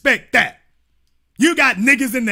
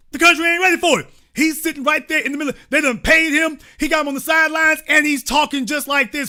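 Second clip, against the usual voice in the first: heated rant; 6 semitones higher, faster than usual. Real, not acted. Host fired up for the audience, excited rant. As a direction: preach it at the viewers. Host ranting about coach.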